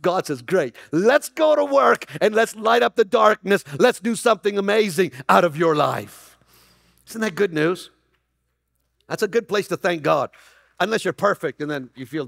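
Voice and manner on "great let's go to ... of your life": excited God voice. Acted.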